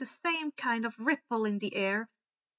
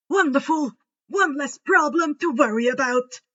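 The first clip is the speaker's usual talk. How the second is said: bright, louder exclaim of relief; delighted cheer; 3 semitones higher, 8 dB louder than usual. A narrator's reading. Headmaster cheering the group, loud and delighted. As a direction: cheer the good news to the group.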